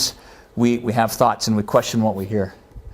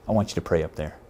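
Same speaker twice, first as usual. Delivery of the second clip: soft voice; 6 semitones lower, 7 dB quieter than usual. Retold or imitated. Quiet voice of God again.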